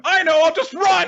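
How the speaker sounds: high, loud hammed-up panicked yell; mock panic. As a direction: yell it in comic desperation, voice way up. Acts out panicked villain fleeing.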